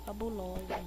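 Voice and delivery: normal talking voice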